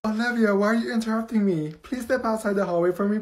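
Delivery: normal talking voice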